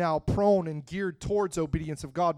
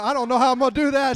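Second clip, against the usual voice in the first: fast, higher mock worry; quoting friend, louder; 7 semitones higher, 9 dB louder, much faster than usual. Preacher imitates his friend's panic over giving up pasta, fast and higher. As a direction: play the friend fretting about carbs. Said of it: "She's like".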